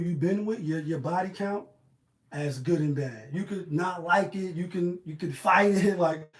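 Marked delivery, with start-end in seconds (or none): none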